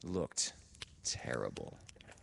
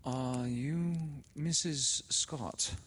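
much higher, slow, nervous voice; Laura's timid question; 10 semitones higher, 3 dB louder, much slower than usual. A narrator's reading. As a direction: ask timidly, drawing it out. Laura asks at the door.

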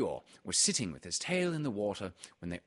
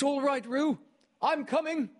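put-on high, louder boyish call; 5 dB louder than usual. A narrator's reading. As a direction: call out to reassure Roo. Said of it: "called Christopher Robin".

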